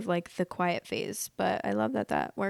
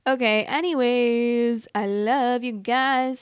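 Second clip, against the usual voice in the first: slow, higher affectionate sing-out; drawn-out sign-off; 3 semitones higher, 3 dB louder, slower than usual. Real, not acted. Vlogger telling viewers she loves them, drawn-out sign-off. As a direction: stretch each word to the viewers.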